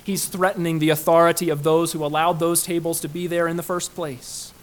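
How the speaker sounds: usual speaking voice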